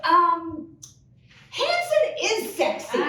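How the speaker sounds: high, prim mock of the mom; prissy correcting mom. Comic mimics mom fixing the sign's grammar, prissy. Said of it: "She goes".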